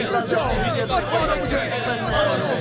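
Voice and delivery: frantic high pleas under blows; pleading in fear. Acted. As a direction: beg them to stop hitting you. Being beaten by the crowd.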